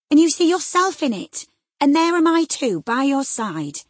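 high, loud, slowing at the end; urgent shout. Acted. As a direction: drive it home, then ease off. Nelly shouting at frightened Catherine.

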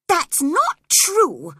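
put-on a sick boy crying out in denial; drawn-out upset protest. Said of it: "cried the boy".

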